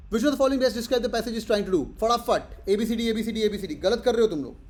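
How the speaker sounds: loud, high-pitched quizmaster push; urgent calling out. Real, not acted. Teacher hurries students through options, calling out. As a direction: hustle the class for answers. Hurrying class through a live question.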